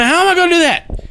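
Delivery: very high, loud retold disbelief; dismayed inner thought. Investigator picturing explaining it to cops, high loud dismay. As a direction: voice jumping up in mock dismay.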